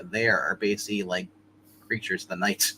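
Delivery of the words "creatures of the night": ordinary talk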